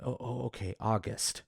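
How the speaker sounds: low-volume stammered mock mutter; imitated muttering. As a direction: mutter it under your breath, as the dazed mayor. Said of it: "the mayor muttering to himself".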